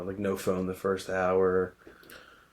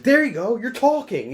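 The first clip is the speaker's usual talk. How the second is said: much higher, louder yelled praise; mock outburst; 13 semitones higher, 8 dB louder than usual. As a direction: bark it out like a triumphant coach. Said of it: "He's like".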